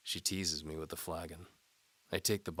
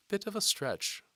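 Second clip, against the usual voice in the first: higher, louder teasing lady voice; seductive noblewoman; 6 dB louder than usual. A narrator's reading. Antimony dares the knight to follow. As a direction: tease the knight like a bold noblewoman.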